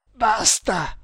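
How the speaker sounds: high, strained gasp of agony; gasped plea. Acted. The tortured prisoner begs his captor, gasping. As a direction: rasp out a pained plea. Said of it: "rantolò".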